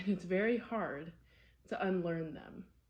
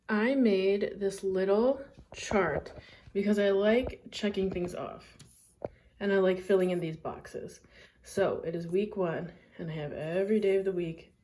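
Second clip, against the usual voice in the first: loud; 8 dB louder than usual. Real, not acted. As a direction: push it out loudly. Showing chart, much louder.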